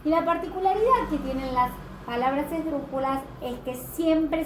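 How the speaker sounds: ordinary talk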